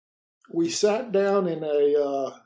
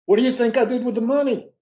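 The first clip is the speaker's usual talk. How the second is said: quicker, louder, pressing repeat; repeated question; 9 semitones higher, 9 dB louder, faster than usual. Retold or imitated. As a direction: push the question again, fast and loud. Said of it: "he repeated it again".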